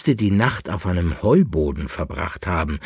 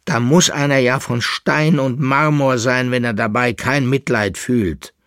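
raised, higher voice in indignation; angry cry; 5 semitones higher, 5 dB louder than usual. A narrator's reading. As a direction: cry out a reproach, loud and high. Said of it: "mit einem zornigen Blick an und rief". (zornig und vorwurfsvoll gerufen)

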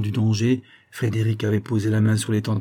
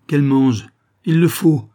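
plus haut et plus fort, obsédé; éclat; 4 semitones higher, 6 dB louder than usual. A narrator's reading. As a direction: crie l'obsession. Répétition, puis éclat. (répété cette fois avec force)

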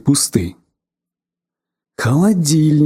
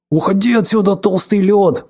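mock growl, a bit higher; playful toy-fight growl; 5 semitones higher than usual. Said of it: "прорычал мальчик". (шуточное рычание)

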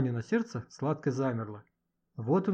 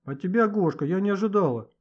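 higher, louder wounded reproach; offended, with raised pitch; hurt reproach; 5 semitones higher, 5 dB louder than usual. Acted. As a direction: reproach a friend who let you down. Tearful reproach to Gosha.

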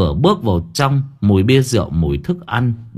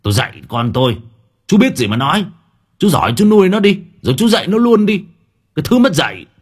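furious shout; 10 semitones higher, 5 dB louder, faster than usual. Acted. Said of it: "Ông Lộc quắc mắt".

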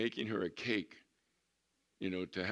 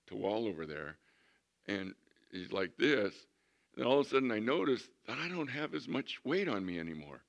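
normal talking voice

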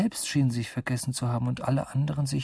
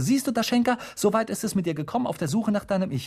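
put-on higher, fast scolding old woman; tut-tut like a grandma; a hint of a grandmother; 7 semitones higher, faster than usual. A narrator's reading. Deaf grandmother on the stove lightly scolds her granddaughter.

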